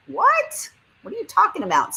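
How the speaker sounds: sudden high startled what; baffled. Real, not acted. Reading a baffling chat comment, high surprised disbelief. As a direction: thrown by a message she cannot follow.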